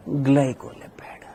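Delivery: hushed whisper of glycolipid; whispered answer. Real, not acted. Teacher giving students the answer to his question, whispered. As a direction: whisper it like a prompt to the class.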